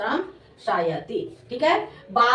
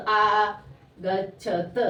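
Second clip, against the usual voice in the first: आगच्छत very slowly, each syllable apart; 4 semitones lower, much slower than usual. Shown as a demonstration. Teacher dictating to pupils, आगच्छत slowly syllable by syllable. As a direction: sound out आगच्छत in long separate syllables.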